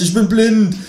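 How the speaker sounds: high-pitched panicked yell; screamed. A narrator's reading. Dazzled man screaming that he is blinded. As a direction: cry out in shock, voice leaping up. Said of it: "schrei ich".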